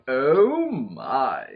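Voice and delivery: drawn-out swoop up, then a low drop; lingering, suggestive exclamation; George Takei. Acted. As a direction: stretch both words and let them sink. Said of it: "a clip of George Takei going".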